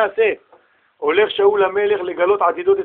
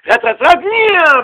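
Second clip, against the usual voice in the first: excited rush; 9 semitones higher, 6 dB louder, slower than usual. Retold or imitated. Mimics car racing off.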